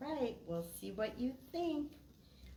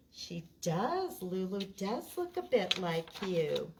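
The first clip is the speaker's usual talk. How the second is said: lively storytime read with character voices; reading to children; 6 dB louder, slower than usual. Acted. Librarian reads Oliver's Tree to children, lively and bright. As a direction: read it out to a room of kids.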